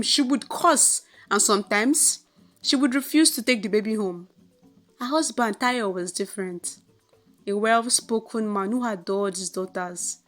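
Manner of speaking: ordinary delivery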